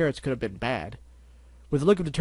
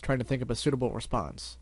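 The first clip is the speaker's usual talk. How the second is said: ordinary delivery, nothing marked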